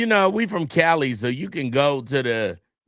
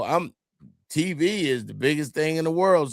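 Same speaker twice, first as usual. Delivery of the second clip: usual speaking voice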